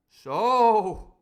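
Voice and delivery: loud drawn-out outraged cry. Acted. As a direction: bellow the word in outrage. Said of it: "cried Silver".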